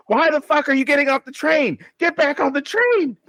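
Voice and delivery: mock furious mom scolding the TV; mom yelling at the screen. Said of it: "she gets mad".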